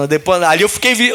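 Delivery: normal talking voice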